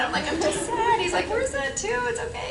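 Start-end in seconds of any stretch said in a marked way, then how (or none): 0.3-1.0 high-pitched imitation of crying; comic weepy squeak; mock tearful. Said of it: "and I started crying". 1.3-2.5 soft, high imitation of consoling; retold comforting. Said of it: "he's such an empath".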